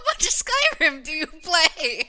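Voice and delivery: laughing through the words. Real, not acted. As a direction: ask it while cracking up. Laughing at the loud music.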